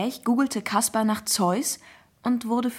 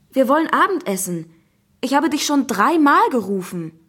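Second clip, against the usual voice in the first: exasperated, louder and higher; scolding mother; 4 semitones higher, 7 dB louder than usual. Acted. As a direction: scold him for ignoring your calls. Mother scolds son at door. (vorwurfsvoll schimpfende Mutter)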